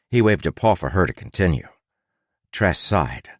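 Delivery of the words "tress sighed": plain reading voice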